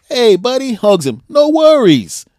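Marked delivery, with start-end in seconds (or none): 0.1-0.7 higher, bright friendly greeting to a stranger; imitating a cheerful fan. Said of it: "looks at the guy and goes". 1.3-2.2 higher, bright friendly greeting to a stranger; imitating a cheerful fan. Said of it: "looks at the guy and goes".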